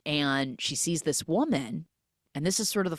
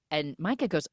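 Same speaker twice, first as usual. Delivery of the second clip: ordinary talk, nothing marked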